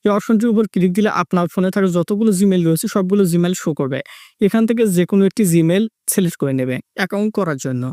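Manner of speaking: usual speaking voice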